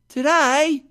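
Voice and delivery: put-on high, loud boy calling back in surprise; boy's voice. A narrator's reading. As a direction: answer as a surprised street boy, pitched high. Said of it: "replied the boy".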